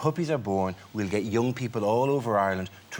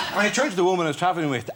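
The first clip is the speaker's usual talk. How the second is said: ordinary talk, nothing marked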